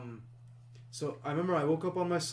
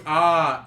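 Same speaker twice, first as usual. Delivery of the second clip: mock scream; 10 dB louder than usual. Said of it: "it's not me going".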